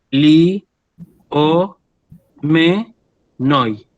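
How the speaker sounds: "li, o, me, noi" as four isolated syllables with pauses. Shown as a demonstration. Teacher separating a Greek word for students, syllable by syllable. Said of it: "Yo digo".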